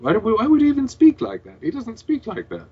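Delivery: high, loud disbelieving cry; can't believe anyone would talk like that; incredulous outburst. Real, not acted. Mocking the fanfic's Edward dialogue, in loud, high disbelief.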